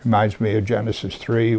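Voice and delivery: ordinary talk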